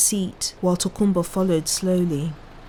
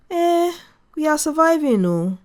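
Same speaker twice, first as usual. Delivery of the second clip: father's high rueful reply; 9 semitones higher, 4 dB louder than usual. A narrator's reading. Father's weary pidgin reply.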